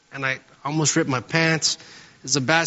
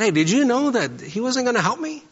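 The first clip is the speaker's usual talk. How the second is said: much higher, indignant question; retold anger; 10 semitones higher than usual. Calling his wife Rebecca while mad, much higher and indignant. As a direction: pitch it up like a man fuming on the phone. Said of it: "So I got really mad".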